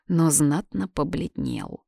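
usual speaking voice

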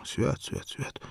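hushed whisper against the thunder; whispered prayer. Acted. Frightened old man whispers a charm at the thunder. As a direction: breathe the charm under your breath.

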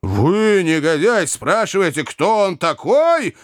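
high, indignant outburst; outraged. A narrator's reading. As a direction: throw the question back in outrage. Enraged brother cuts in.

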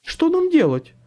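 loud, high-pitched helpless question; frightened man's voice shooting up high; panicked squeak. A narrator's reading. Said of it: "панически пискнул второй мужской голос".

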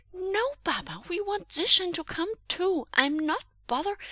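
put-on high, loud child voice, near tears; a small boy begging his father; pleading little boy. A narrator's reading. Boy begging his father to let his friend come, high and pleading.

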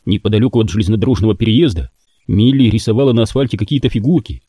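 ordinary delivery, nothing marked

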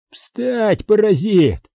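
high, forceful acted command; commanding order. A narrator's reading. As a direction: order a captive to his feet, pitched high. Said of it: "приказал он". (властный приказ, высоко)

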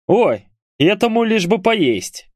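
high, drawn-out teasing; joking jibe. A narrator's reading. Bury joking about the fisherman's appetite, high drawn-out tease. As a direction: stretch it out in mock exasperation. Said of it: "в шутку заметил Бурый".

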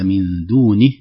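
"دونه" ending with the kasra barely sounded; "دونه" with a weak final vowel before the ha; incomplete kasra. Shown as a demonstration. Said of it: "نلاحظ أن بعضنا يقول". (خطأ: كسرة النون غير محققة)

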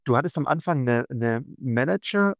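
ordinary talk, nothing marked

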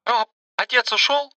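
put-on high, begging young girl's voice; pleading girl. A narrator's reading. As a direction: plead like a scared teenager. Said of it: "взмолилась она".

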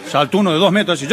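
normal talking voice

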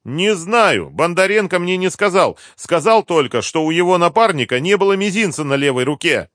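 high, loud, insistent denial; cornered captive. A narrator's reading. Driver tells interrogators the little he knows, defensive. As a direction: insist you were told almost nothing.

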